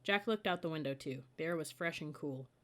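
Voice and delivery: usual speaking voice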